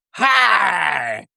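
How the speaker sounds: mock umpire call. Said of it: "I'll do my best impression here".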